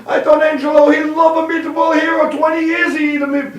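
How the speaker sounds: high mock-wailing Italian accent; crying Italian widow. Italian widow at the group funeral, high mock wail. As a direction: lament like a hammed-up Italian widow. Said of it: "The Italian woman".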